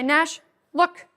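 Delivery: clear, firm "Nash, look"; firm cue to dog. Shown as a demonstration. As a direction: call the dog's name and cue crisply. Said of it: "I'm gonna show you how that's done".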